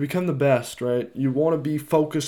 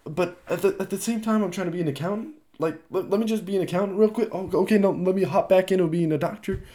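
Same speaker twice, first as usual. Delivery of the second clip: higher, dithering back and forth; scatterbrained dithering; 7 semitones higher, faster than usual. Retold or imitated. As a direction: act unsure between two careers. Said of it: "you can't be like".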